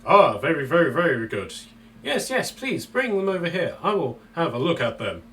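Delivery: an excited bird merchant; mysterious shopkeeper. Acted. GM voices Kenku shopkeeper.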